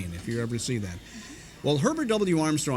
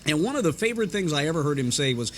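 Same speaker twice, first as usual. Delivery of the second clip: ordinary talk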